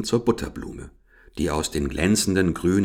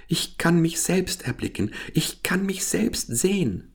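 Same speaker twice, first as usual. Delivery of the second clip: much higher, preening lily voice; vain lily; 10 semitones higher than usual. Acted. The narcissus lily boasts to Gerda in a much higher, preening voice. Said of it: "sagte die Pfingstlilie".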